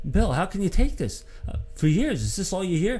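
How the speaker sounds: high-pitched, mildly incredulous question; retold shock. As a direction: imitate a friend's stunned disbelief. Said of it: "just in shock looking at him".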